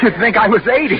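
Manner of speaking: laughing. Acted. Oliver laughs off the remark.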